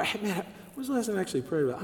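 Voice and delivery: quick, soft, hurried mutter to himself; fast, offhand, under the breath; rushed aside. Retold or imitated. Believers rushing past prayer, fast and offhand.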